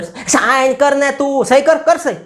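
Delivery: high, loud, drawn-out pressuring demand; forceful coercion. Acted. Victor nations force Germany to sign the treaty, insistent and bullying. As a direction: lean on them to give in, pitch pushed right up. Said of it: "અને બળજબરીથી સહી કરાવી".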